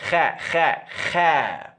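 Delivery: kha kha kha, each a throat-clearing rasp; kha repeated with a harsh throat scrape; Arabic kha. Shown as a demonstration. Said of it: "trying to bring up phlegm from the back of your throat".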